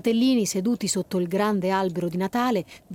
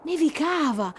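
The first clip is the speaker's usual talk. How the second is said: nevicava lifted higher, in delight; bright wonder; 6 semitones higher than usual. Shown as a demonstration. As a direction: call out the snowfall happily.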